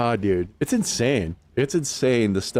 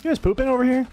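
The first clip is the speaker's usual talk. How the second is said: high silly voice; faster than usual. Pretend. Talking to game characters, high voice.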